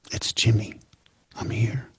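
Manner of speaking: soft bedside whisper. Retold or imitated. Grandson at his dying grandmother's bed, soft whisper.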